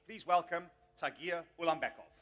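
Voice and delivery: loud ring-announcer welcome; bringing the fighter up on stage; announcer call-out. Real, not acted. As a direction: belt out the name for the crowd.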